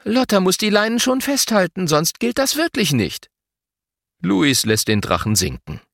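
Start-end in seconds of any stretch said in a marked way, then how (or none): 0.0-3.3 higher-pitched fair referee; mildly conceding mother. A narrator's reading. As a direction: admit it in a raised, explaining tone. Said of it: "Mama gibt zu".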